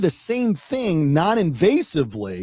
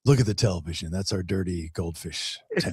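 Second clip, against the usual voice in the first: lower, soft soothing spa voice; spa talk voice; 9 semitones lower, 6 dB quieter than usual. Pretend. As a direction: lull a client like a spa therapist. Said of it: "your spa talk voice".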